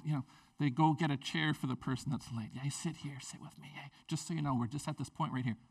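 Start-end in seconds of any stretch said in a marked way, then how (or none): 2.5-5.6 soft, hushed aside to a latecomer; hushed welcome. Retold or imitated. Church member quietly welcoming a latecomer, hushed. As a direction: murmur it so the group isn't disturbed.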